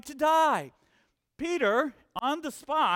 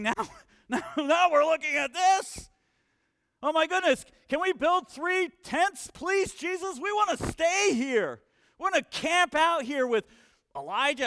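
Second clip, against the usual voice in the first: higher, louder eager begging to stay; excited disciples; 5 semitones higher, 5 dB louder than usual. Retold or imitated. Preacher voicing disciples begging Jesus to stay, excited. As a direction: gush like a thrilled disciple who wants to camp there.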